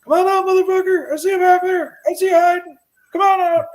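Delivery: high, loud challenge yelled into the dark; retold shout. Homeowner challenging a supposed intruder in a dark basement, shouted high. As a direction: re-enact yelling down at a hidden intruder.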